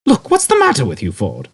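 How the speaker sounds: loud high exasperated cry. A narrator's reading. Arthur exasperated with Ford, loud. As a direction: snap in frustration.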